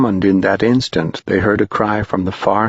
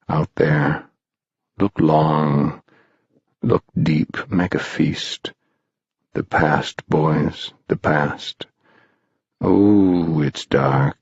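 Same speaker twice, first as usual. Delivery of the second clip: Mound Shroud, eerie guide; 3 semitones lower than usual. A narrator's reading. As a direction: lure the boys into the dark, in low, lingering phrases.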